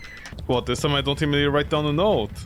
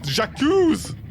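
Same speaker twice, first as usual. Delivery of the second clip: mock French accusation; 6 semitones higher than usual.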